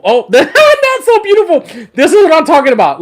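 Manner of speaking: high, loud laugh of delight. Real, not acted. Showing viewers his rod, laughing with delight. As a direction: gush with laughing joy.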